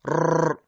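"رر" as a long rolling trill; repeated trilled r. Shown as a demonstration. Teacher showing the faulty vibrating raa, long trilled r. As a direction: roll the r of "رر" many times. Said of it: "لرزشی مکرر".

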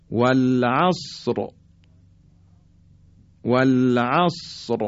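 slow "والعصر" with a thick final ر; heavy ra. Shown as a demonstration. Teacher giving an example of thick ra, slow heavy ر in "والعصر". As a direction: stretch "والعصر" and close on a heavy ر.

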